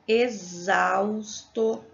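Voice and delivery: exausto drawn out slowly, x said as z; slow demo word. Shown as a demonstration. Teacher reading the example word to her class, drawn out slowly. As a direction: stretch exausto syllable by syllable with a z sound. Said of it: "Essa primeira".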